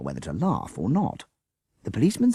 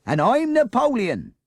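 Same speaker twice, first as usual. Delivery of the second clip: sarcastic; 6 dB louder than usual. A narrator's reading.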